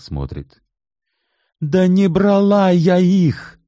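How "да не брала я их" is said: high, loud, slow desperate shout. A narrator's reading. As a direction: yell the denial. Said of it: "закричала Свиягина". (крик, громко и медленно)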